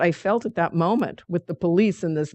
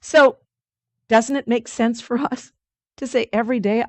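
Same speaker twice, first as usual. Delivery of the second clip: ordinary delivery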